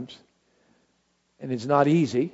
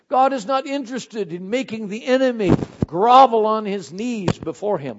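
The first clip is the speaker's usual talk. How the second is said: normal talking voice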